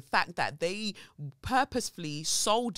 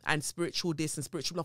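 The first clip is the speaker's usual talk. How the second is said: ordinary talk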